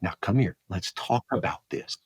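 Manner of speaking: hushed, low fatherly invitation; very quiet. Acted. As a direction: draw them in softly. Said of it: "I get very quiet".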